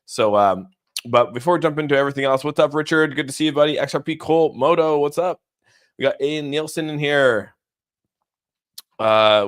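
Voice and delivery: normal talking voice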